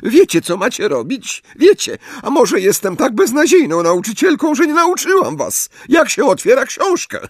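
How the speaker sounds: high, loud, bitter sarcasm; shrill hysterical teacher. A narrator's reading. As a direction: lash out at the class, near tears. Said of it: "piskliwym, nieco histerycznym głosem". (histeryczna nauczycielka, wysoko i głośno)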